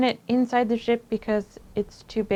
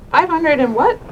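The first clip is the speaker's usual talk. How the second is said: louder, higher disbelieving question; incredulous; 5 semitones higher, 8 dB louder than usual. Real, not acted. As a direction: ask in shocked disbelief.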